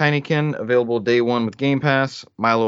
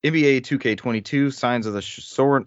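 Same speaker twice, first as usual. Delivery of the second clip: ordinary talk, nothing marked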